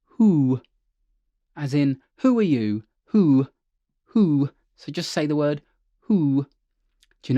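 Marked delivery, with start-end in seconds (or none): 0.1-0.6 who, H breathed out before the oo; who, air first, then the oo; who with a breathy H. Shown as a demonstration. Coach demonstrating to students: who, breathy H onset. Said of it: "we're gonna say". 2.2-4.5 Who, H breathed before the vowel; aspirate who. Shown as a demonstration. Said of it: "we're gonna say who".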